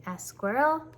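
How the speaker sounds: ordinary delivery, nothing marked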